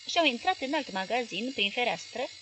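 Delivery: ordinary delivery, nothing marked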